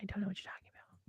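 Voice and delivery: very soft, voiceless whisper; hushed whispered repeat; whispered denial. Real, not acted. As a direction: whisper the denial under your breath. Repeats the denial under breath.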